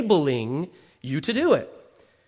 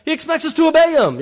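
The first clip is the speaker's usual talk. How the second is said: higher and louder, laughing as it bursts out; loud, high, laughing repeat of He expects us to obey him; 9 semitones higher, 8 dB louder than usual. Shown as a demonstration. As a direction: shout it up high through a laugh.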